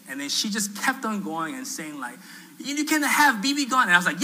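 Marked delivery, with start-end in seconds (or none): 2.6-3.8 high-pitched mock objection; retold protest, pitched up; scolding. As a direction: imitate her indignant telling-off. Lady scolding him, acted quarrel.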